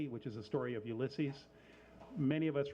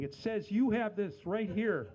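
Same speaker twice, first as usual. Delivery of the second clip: higher, louder, slower insistence; excited customer; 6 semitones higher, 5 dB louder, slower than usual. Retold or imitated. Host imitates customers showing phones to the shop, excited and insistent. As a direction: point it out eagerly, stretching the words.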